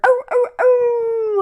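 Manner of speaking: high, slow ghostly hooting; eerie howl. Acted. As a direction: wail off into the dark. Creature cries in dark.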